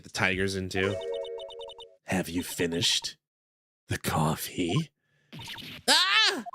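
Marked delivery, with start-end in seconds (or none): 5.9-6.4 sharp high yelp of surprise; startled yelp. Acted. Caught snooping, a startled shriek. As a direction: jump out of your skin with a cry.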